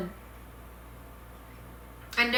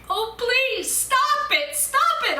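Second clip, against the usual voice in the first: much higher, louder begging cry; anguished pleading cry; crying plea; 14 semitones higher, 5 dB louder, slower than usual. Acted. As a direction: beg desperately to stop. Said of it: "I cried".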